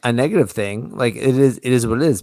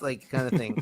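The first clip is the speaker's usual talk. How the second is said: ordinary talk, nothing marked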